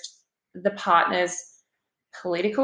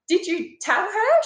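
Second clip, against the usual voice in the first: alarmed question; 10 semitones higher than usual. Retold or imitated. Anxious question to counsellor.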